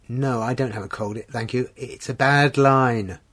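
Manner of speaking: ordinary delivery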